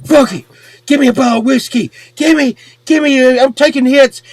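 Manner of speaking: high-pitched, urgent demanding rush; acting out a desperate guy calling for drink; worked-up demand. Retold drunk demand for whiskey.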